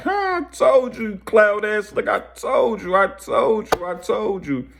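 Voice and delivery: high, drawn-out gloating, imitated; mocking laughter. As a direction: crow it like a hammed-up told-you-so.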